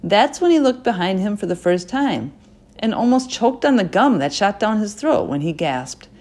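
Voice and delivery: plain reading voice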